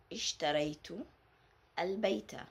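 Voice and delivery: normal talking voice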